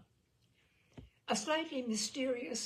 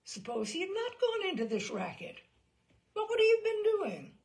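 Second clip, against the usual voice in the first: corn and ham gangster voice; 11 semitones higher, 6 dB louder than usual. Retold or imitated. Gangster telling the reporter what else he'd be doing, hammy and climbing. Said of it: "with a little bit of corn and ham somewhere at the back of his throat".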